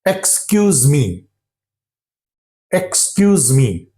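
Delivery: "Excuse me" with the opening "ek" fully sounded; careful learner's "Excuse me", first syllable kept; full pronunciation. Shown as a demonstration. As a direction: say every sound of "Excuse me", starting on "ek". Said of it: "We normally produce the sound".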